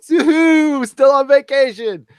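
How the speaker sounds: high, slow and loud sing-song; sing-song yoo-hoo. Retold or imitated. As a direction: call it out brightly, teasing. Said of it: "I'm all".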